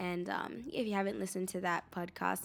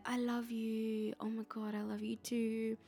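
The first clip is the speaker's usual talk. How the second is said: softly imitated gushing lovers; mock lovey-dovey; 4 dB quieter than usual. Host imitating pod daters two days in, mock lovey-dovey. Mimicking dating-show couples declaring love.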